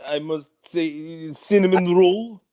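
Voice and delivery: put-on slow, heavy Russian accent. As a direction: drag it out like a KGB agent. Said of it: "in a K G B accent".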